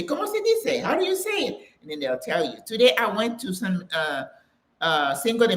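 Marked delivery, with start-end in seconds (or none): 0.0-1.6 high questioning voice; asking how to say. Retold or imitated. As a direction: ask it high and curious. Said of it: "and you say".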